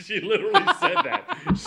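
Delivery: high, laughing; can't stop laughing. Real, not acted. Cracking up while telling friends, laughing.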